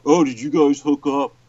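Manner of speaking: lightly mocking a curious kid; nosy kid. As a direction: ask it like an excitable kid, a little exaggerated. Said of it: "now I sound like a fucking kid".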